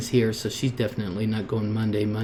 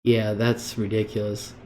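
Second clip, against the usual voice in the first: usual speaking voice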